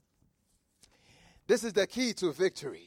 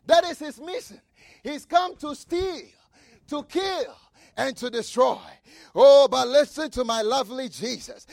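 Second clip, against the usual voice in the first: rising, pounding sermon cadence with repeated hammer lines; fiery preaching; 5 semitones higher, 6 dB louder than usual. Acted. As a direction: preach it hard, building to each punch. Preacher at full force.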